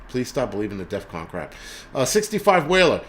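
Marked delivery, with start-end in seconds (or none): none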